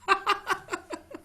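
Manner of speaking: high-pitched delighted laugh. Real, not acted. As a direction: burst into a high chuckle. Se ríe del hijo que lo arregló. (risa aguda y divertida)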